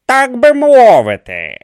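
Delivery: high, loud, strained reply; forced out. A narrator's reading. Kumi answers Dios's challenge, forced out. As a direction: squeeze the words out slowly and with effort. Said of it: "вичавив із себе Кумі".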